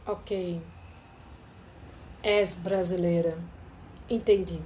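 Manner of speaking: slow, low, knowing mock doctor; a slow knowing Portuguese doctor. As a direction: drawl it like a doctor who just understood. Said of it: "ele olhou minha ficha e disse".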